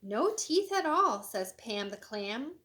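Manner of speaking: a touch of storybook alarm; mild character exclamation. Acted. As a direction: exclaim it like a dismayed clam.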